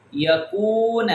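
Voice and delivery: یکون with the oo held long; long vowel. Shown as a demonstration. Teacher drills wao madda, یکون drawn out. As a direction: stretch the middle of یکون. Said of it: "کاف کو break کر کے لمبا".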